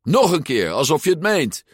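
high, impatient command; angry order. A narrator's reading. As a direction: demand it from your henchman. Angry boss repeats his order. (geïrriteerd bevelend)

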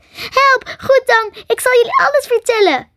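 frantic, very high squeal; terrified small-animal squeak; squeaking squirrel. A narrator's reading. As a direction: squeal in panic and give in. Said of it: "piepte het".

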